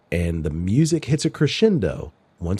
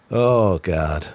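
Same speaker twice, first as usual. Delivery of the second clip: normal talking voice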